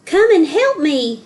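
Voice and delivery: high, loud call for help. A narrator's reading. Joseph calls to the village men, loud call. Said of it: "he called".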